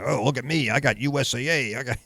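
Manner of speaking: mock show-off. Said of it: "I'm like".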